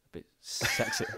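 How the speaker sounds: close-mic sultry murmur; leaning into the mic. Real, not acted. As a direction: lean in close and purr it. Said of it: "Why did you lean into the mic to say that?".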